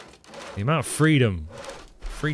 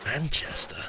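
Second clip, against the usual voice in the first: whisper; 5 semitones higher, 4 dB quieter than usual. Real, not acted. Guessing his accent, whispered.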